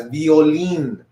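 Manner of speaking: Violín as "bee-oh-LEEN"; Spanish pronunciation. Shown as a demonstration. Teacher invites class to repeat, Spanish pronunciation. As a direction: model "Violín" in Spanish, final syllable stressed. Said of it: "Try to say that".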